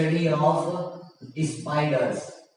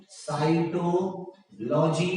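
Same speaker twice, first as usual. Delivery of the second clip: Cytology very slowly, with a gap before logy; drawn out; much slower than usual. Shown as a demonstration. Teacher repeating option C for students, drawn out. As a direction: split cytology into slow separated parts.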